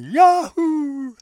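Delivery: high, drawn-out cowboy-style whoop; imitated holler. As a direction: holler it like the cartoon guy on the beach. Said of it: "this guy on the beach hollering".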